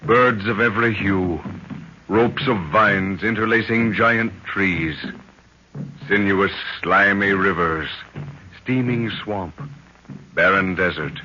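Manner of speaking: low, slow, brooding narration; spoken like an old radio serial opening; ominous announcer intro. A narrator's reading. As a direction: paint a dark jungle in each phrase. Dramatic opening narration of the jungle.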